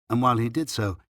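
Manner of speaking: ordinary delivery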